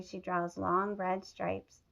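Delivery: plain reading voice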